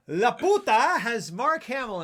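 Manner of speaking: slow, loud and emphatic with a laugh; overly enthusiastic, drawn out; too vigorous. Real, not acted. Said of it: "I said that too vigorously".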